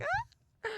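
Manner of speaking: high mock shriek; imitating the shriek. Explaining she joined the creature's shriek, mock scream. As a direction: replay the scream. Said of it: "I was part of that shriek".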